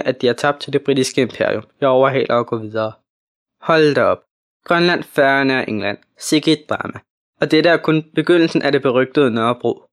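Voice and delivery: ordinary delivery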